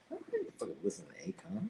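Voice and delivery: fast hushed whisper; whispered aside. Retold or imitated. Telling chat about [name], quick whispered aside. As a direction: confess it under your breath. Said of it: "I was like".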